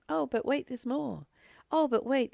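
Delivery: mock late-night sales pitch, lightly done; mock infomercial pitch. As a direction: tease it like a TV ad host. Mocks endless consumer offers.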